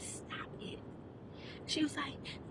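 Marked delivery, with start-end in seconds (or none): 0.0-0.8 whispered, softer stop it; hushed stop. Retold or imitated. Quietly warning the girl in class, whispered. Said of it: "I said".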